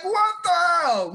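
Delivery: high, drawn-out incredulous cry; incredulous outcry. Retold or imitated. Viewer reacting to the scene, high incredulous outcry. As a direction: pitch it way up and stretch it out. Retold shocked reaction, laugh before it.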